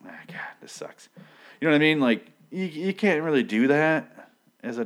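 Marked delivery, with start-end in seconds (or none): 0.1-1.1 whispered under the breath, mock despair. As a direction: hiss it like a doctor giving up. Acted panicking doctor, unvoiced.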